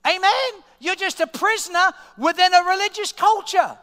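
high, loud preacher's push; fired-up sermon delivery; preaching at full force. Acted. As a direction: drive it home to the congregation. Preaching climax opening the clip.

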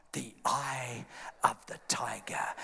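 low, whispery reveal; hushed, ominous storytelling; dramatic hush. Retold or imitated. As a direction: drop down into an intense whisper. Dramatic reveal of Rocky's return.

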